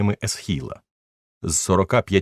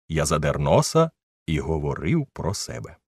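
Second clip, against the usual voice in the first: ordinary delivery, nothing marked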